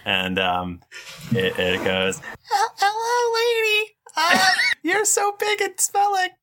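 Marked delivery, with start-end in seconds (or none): 2.2-6.3 squeaky, very high mouse voice; tiny mouse voice. Acted. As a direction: squeak like a timid little mouse.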